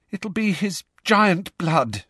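high, slow, shaken voice; rattled and high-pitched; shaky. A narrator's reading. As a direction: say it shakily, drawing it out. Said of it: "said Hermione shakily".

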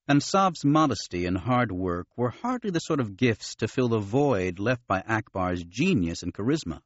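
ordinary delivery, nothing marked

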